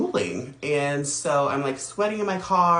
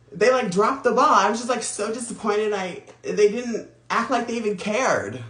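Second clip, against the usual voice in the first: exasperated; 7 semitones higher, 4 dB louder than usual. Real, not acted. Venting about the repair shop.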